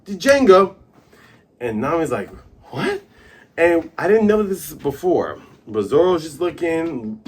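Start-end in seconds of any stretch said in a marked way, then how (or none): 0.1-0.8 high, loud burst on the name; called-out command. Retold or imitated. Acting out hypnotist's countdown call. 2.7-3.0 high-pitched surprised squeak; startled question. Retold or imitated. Acting out Nami's startled reaction.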